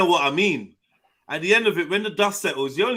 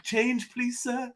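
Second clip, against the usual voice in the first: higher, pleading call for change; begging; 7 semitones higher, much slower than usual. Acted. Mimicking a beggar asking passers-by, higher pleading voice. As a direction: beg politely like someone asking for coins.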